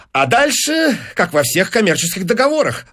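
high-pitched, eager patter; rattling patter. Acted. Businessman explaining contract terms, glib patter. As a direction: launch breezily into the small print. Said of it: "затараторил Файнс".

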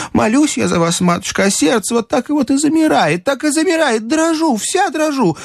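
very high, gushing servant chatter; трещит Марфа. Acted. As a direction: rattle on in a high, overwrought voice. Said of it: "трещала Марфа". (высокая восторженная бабья трескотня)

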